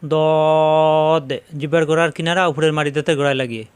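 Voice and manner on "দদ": সদ said thick and heavy, louder; heavy letter sad. Shown as a demonstration. Teacher leading the class to repeat a heavy letter, thick and full. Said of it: "জিহ্বা নিচে তাতে চাপ দিয়ে".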